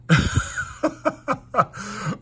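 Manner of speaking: high, helpless laugh at a broken piece; laughing in half-despair; rueful laugh over a shattered figure; real laughter. Real, not acted. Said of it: "I mean, I'm laughing".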